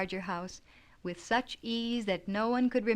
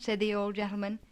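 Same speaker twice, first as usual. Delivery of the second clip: ordinary delivery, nothing marked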